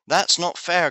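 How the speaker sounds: high-pitched protest, mildly played; storybook cry of unfairness. A narrator's reading. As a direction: object with the voice lifted. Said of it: "cried Biff".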